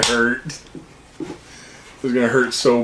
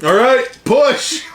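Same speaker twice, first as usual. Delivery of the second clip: combat callout; 10 semitones higher, slower than usual. Real, not acted. Calling the push.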